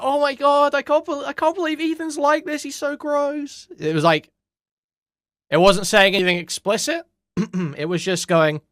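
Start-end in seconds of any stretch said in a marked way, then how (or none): 0.0-3.7 high-pitched mock gushing, jokey; smug mock disgust in a high voice; mocking coy gush. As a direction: imitate her fake shock, much higher. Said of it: "In, like, a jokey way".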